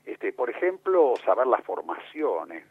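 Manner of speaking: ordinary talk, nothing marked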